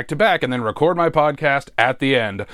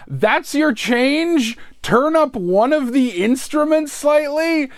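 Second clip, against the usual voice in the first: high, drawn-out disbelieving questions; mock incredulity; 8 semitones higher, slower than usual. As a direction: replay your disbelief, pitched way up. Acts out disbelief at sister's request.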